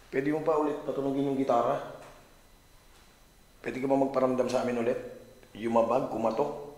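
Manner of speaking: loud plea into the dark; calling out loud. Real, not acted. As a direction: call out to the spirits, loudly. Calling spirits to respond.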